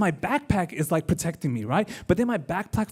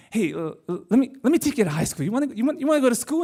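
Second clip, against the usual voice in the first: nervous bus driver; 5 semitones higher, faster than usual. Retold or imitated. Bus driver nervously offering ride.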